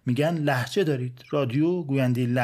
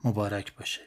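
put-on old father; 5 semitones lower, 5 dB quieter than usual. Father's replies to his excited son.

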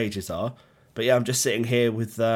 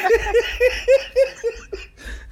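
high-pitched burst of laughter; 4 dB louder than usual. Real, not acted. Laughing at his own nurse story.

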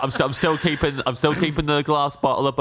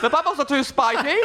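high laughing prissy whine; spoiled boy king. Pretend. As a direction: whine like a pampered prince. Joining the boy king mockery.

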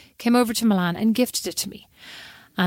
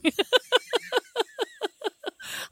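high squeal laugh; 4 dB quieter than usual. Retold or imitated. Laugh in Julie voice.

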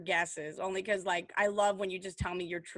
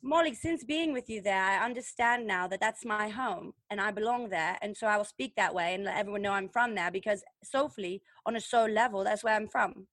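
earnest mock-British accent; put-on British accent. Host declares London home to Molly, put-on British accent. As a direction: play at being British, soulfully. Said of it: "That was closer".

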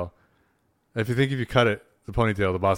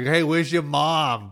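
higher, slower mock Boston accent; 5 semitones higher, 3 dB louder, slower than usual. Boston local asking a kid about mom, mock Boston accent. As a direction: play a Boston local calling out. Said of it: "Very Boston".

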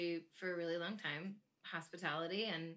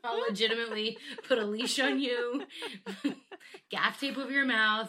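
laughing threat; 4 semitones higher, 9 dB louder than usual. Retold or imitated. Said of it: "he was like".

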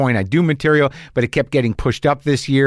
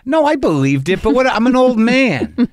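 comic protest; 11 semitones higher, 3 dB louder than usual. Real, not acted. Host excusing his late research to guest, comically defensive. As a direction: protest high and exasperated, playing helpless.

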